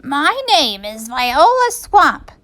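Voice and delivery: slow, scratchy witchy teacher. A narrator's reading. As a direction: announce yourself menacingly in a scratchy voice. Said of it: "in a scratchy voice".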